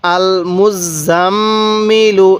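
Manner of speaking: "আল মুজাম্মিলু" very slow, double m hummed long and level; ghunnah. Shown as a demonstration. Teacher modelling the whole word for learners, long nasal hold. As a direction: hold the nasal mm in "আল মুজাম্মিলু", then release into "ilu". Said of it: "এরকমভাবে শিখব".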